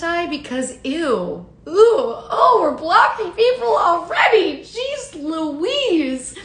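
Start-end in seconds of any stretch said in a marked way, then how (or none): 1.7-6.4 high, loud shocked exclamation; shocked outburst. Real, not acted. Host shocked at viewers blocking each other, loud and high. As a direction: recoil at the chat, pitched way up.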